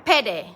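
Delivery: "Pede" loud, short e's, falling; Indonesian pede. Shown as a demonstration. Host modelling the Indonesian pede again. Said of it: "tajuk dia pede".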